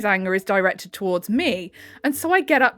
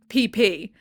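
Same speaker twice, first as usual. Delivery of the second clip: ordinary talk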